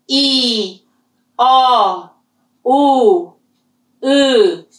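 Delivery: each vowel held on one level tone; teacher drilling tones; vowels in the same tone. Shown as a demonstration. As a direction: lock every vowel into the same pitch. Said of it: "can't you hear the tone".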